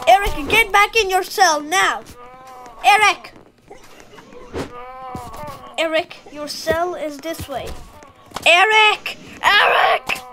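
shouting guard orders; prison guard. Pretend.

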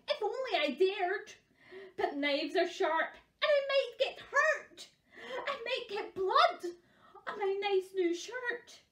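scared cook. Acted. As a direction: play a timid cook working up into fright.

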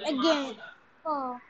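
usual speaking voice

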